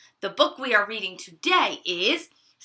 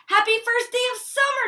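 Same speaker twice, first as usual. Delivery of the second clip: high, fast gleeful call; child calling out happy summer morning; excited shout; 12 semitones higher, faster than usual. Acted. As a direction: shout it out like a kid waking to summer. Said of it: "she shouted".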